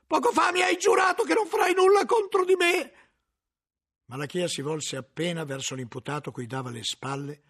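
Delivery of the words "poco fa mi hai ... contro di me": very high, loud accusing yell; furious shout. Acted. Cellarer shouts at the librarian who swore loyalty, furious. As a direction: scream the betrayal at him. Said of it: "urlò il cellario".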